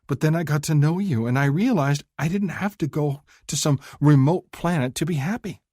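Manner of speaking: sincere confession of happiness; tender young woman. Acted. Woman telling her lover she is happy with him, high and heartfelt.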